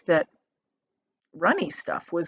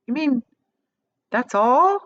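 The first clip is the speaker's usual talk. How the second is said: higher, louder scornful question; disgusted; 5 semitones higher, 6 dB louder than usual. A narrator's reading. Mike Teavee unimpressed by the gum strip, disgusted. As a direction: sneer at the tiny result.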